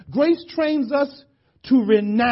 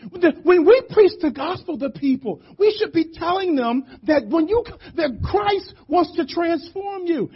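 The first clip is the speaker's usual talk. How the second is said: high, urgent preaching voice; impassioned preaching; 9 semitones higher than usual. Real, not acted. Preacher urging stronger gospel preaching to church, impassioned preaching. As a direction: press it on the congregation with raised pitch.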